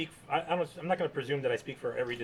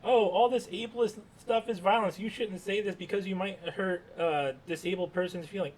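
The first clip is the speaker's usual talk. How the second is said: mocking whiny quote; 6 semitones higher than usual. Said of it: "already you're hearing this".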